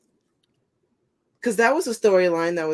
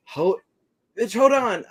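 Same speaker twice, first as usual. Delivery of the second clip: sudden high yell at the screen; urgent shout; 5 semitones higher, slower than usual. Real, not acted. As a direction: shout hold on in panic. Tense moment watching match.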